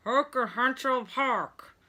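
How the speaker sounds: slow, muffled through gauze; patient mumbling with mouth propped open; mouth full of gauze. A narrator's reading. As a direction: force words past wads in the cheek. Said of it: "said Mister Clydesdale".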